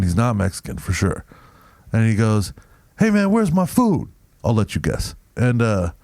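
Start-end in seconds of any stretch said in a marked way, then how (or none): 3.0-4.1 imitating an irate customer, much higher pitch. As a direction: demand it like a put-out customer on the phone. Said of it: "He starts going off on me".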